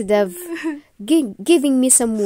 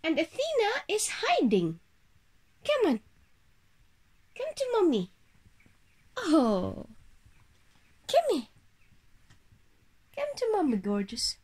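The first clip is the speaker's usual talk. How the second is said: much higher coaxing call; calling the pet; 6 semitones higher, 4 dB quieter than usual. Real, not acted. Owner coaxing hiding pet Athena, high calling voice.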